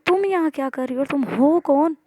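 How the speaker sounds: demanding. Acted. Maya confronting the stranger in her aunt's kitchen, demanding. Acted quarrel between girls.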